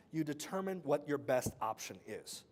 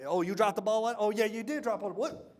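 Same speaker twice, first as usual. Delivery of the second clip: louder, needling "you dropped the ball?"; mocking manager pounce; 4 semitones higher, 5 dB louder than usual. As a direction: pounce on the weakness, taunting. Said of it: "sometimes I might pounce".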